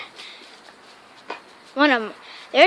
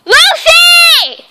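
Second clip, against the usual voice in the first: high, loud drawn-out call; 16 dB louder than usual. Real, not acted. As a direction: shout the name long. Calling dog.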